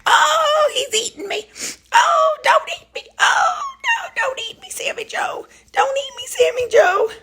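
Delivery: very high mock scream; mock panic. Owner pretending her dog is eating her, mock screams. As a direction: squeal as if being eaten, playfully.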